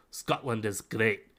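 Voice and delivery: slow Scottish accent with a tapped R. Shown as a demonstration. Game master demos his key phrase in a Scottish accent. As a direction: put on an amateur Scottish accent. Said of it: "that'll be my Scottish accent".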